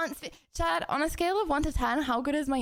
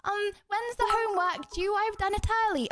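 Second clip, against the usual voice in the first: posh keen pupil impression; 6 semitones higher than usual. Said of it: "they'd be like".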